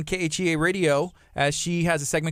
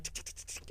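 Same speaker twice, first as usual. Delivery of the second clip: very soft, voiceless ticking; imitated camera clicks; 21 dB quieter, faster than usual. Host imitates the photographer's shutter clicks, soft ticking. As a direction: click off a quick run of shutter noises. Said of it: "But she's like".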